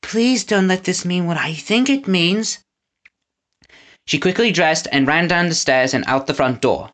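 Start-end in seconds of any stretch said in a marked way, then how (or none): 0.0-2.6 put-on higher, worried girl's voice; anxious plea. A narrator's reading. Winnie fears what the announcement means, anxious.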